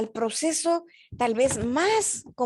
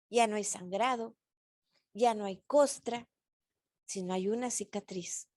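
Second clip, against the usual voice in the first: soft reflection; 3 semitones lower, 8 dB quieter than usual. Real, not acted. Quiet healing image.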